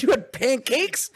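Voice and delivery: very high, loud, drawn-out disbelief; mock shriek of an incredulous wife; outraged wife. Retold wife outraged at pancakes.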